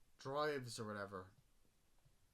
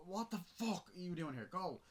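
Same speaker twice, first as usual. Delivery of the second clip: mock angry yell; 9 semitones higher, 3 dB louder than usual. Mother yelling at the stranger.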